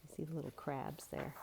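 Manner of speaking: hushed, pointing out the crabs; quiet. Shown as a demonstration. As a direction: point them out softly. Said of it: "whisper repeat".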